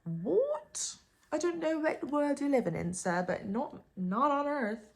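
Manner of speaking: high and loud, scoffing; indignant retort to a creep's invitation; indignant outburst. Real, not acted. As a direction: blast him with disbelief. Outraged refusal of the invite.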